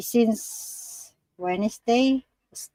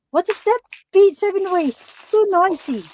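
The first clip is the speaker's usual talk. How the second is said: startled; 11 semitones higher, 5 dB louder than usual. Real, not acted. Startled by noise nearby.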